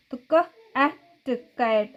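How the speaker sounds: क, अ, ट said as separate short sounds, then blended into कैट; sounding out phonics. Shown as a demonstration. Teacher showing children how to blend a word, sounding out. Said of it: "T का sound ट होता है".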